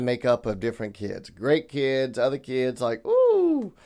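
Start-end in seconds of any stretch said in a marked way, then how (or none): 3.1-3.7 high-pitched, drawn-out "ooh"; comic high ooh about a tricky kid; mock "ooh". Reacting to difficult kids.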